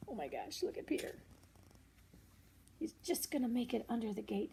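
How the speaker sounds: plain reading voice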